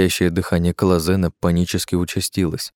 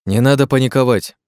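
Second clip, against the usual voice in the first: raised, louder voice holding firm; strained firmness; 5 semitones higher, 5 dB louder than usual. A narrator's reading. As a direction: keep it firm with effort. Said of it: "с трудом сохраняя твёрдость в голосе".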